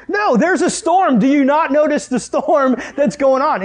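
high, loud, drawn-out protest; hammed-up outrage, stretching the words; exasperated shouting. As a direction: yell slowly to get through to him. Retold shouting at oblivious dad.

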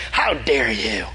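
low, mock-indignant accusation; acted affront in a dropped voice; accusing. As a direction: play a rebellious challenge, pitched low. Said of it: "accuse God and be like".

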